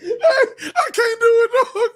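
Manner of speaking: very high, slow, broken by laughter; crying with laughter. Real, not acted. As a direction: give up mid-laugh, helpless. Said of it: "I'm fucking crying".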